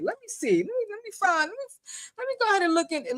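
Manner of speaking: high, softish muttered hurry; imitated map searchers. Host mimicking viewers checking a map, high fussy voice. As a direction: mimic someone busily searching.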